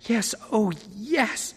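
high, frantic, tearful; frantic tearful yes. A narrator's reading. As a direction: gush it out desperately. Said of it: "A frantic, watery-eyed nodding".

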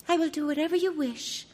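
put-on high, meek young princess. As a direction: give in sweetly to her prince. Princess speaks.

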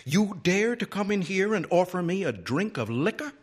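high-pitched affronted librarian; scandalised question, pitched high; stiffened indignation. A narrator's reading. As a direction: take offence in a high, stiff voice. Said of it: "Then she stiffened again".

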